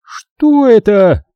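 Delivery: high, loud, slow shocked cry; high shrill horrified supervisor; shrieked question. A narrator's reading. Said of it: "бросила Убин".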